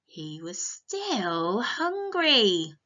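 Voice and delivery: drawn-out, very slow storybook line; slow dramatic refrain. Acted. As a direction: stretch it out for the little ones. Story refrain read slowly.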